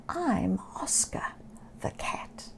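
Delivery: soft unvoiced sad whisper. Oscar answering the rat, timid whisper. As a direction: breathe it out timidly.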